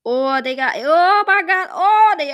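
loud, high excited outburst; excited shout. Real, not acted. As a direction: yell it out in disbelief. Sudden excitement over game result.

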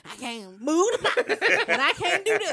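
loud, exasperated mock griping; imitated grumpy complaint. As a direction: complain like a grouchy man, half laughing. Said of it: "he mad at everything".

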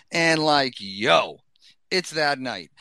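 usual speaking voice

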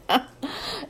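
short laugh of relief; relieved laugh. A narrator's reading. Diamond hearing Moxie only wants weeks off, relieved laugh. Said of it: "Diamond laughed out loud with relief".

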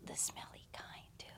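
whispered, confiding; hushed gossip aside. Real, not acted. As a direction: drop to a whisper to share the gross detail. Gossipy aside about the man.

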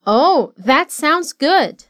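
slow, careful phrasing; slow model. Shown as a demonstration. Teacher modeling a phrase for students to repeat. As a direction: give each word time to land.

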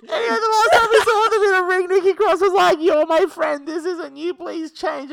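high, loud words squeezed through real laughter; helpless laughter. Real, not acted. Host recapping after a failed impression, helpless laughter. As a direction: push on through a laughing fit, voice shooting up. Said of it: "That was a lot for you".

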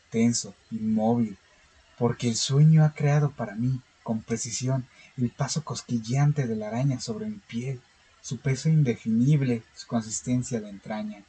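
calm, steady horror story reading; story narration. Acted. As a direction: read it like a measured horror tale. (lectura de cuento de terror)

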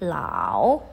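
"Lao" dipping low, then rising; third tone. Shown as a demonstration. Teacher shows students the dipping tone, stretched "Lao". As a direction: press down mid-"Lao", then swing up. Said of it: "ada penekanan di tengah-tengah".

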